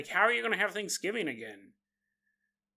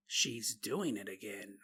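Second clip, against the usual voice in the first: creepy demon voice; 11 semitones lower, 12 dB quieter than usual. Pretend.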